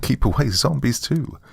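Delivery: ordinary delivery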